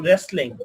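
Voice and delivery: "wrestling" starting straight on the r; silent W. Shown as a demonstration. Teacher demonstrating the W rule to students, silent w in "wrestling". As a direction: say "wrestling" with no w sound. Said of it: "W এর পরে যদি আর হয়".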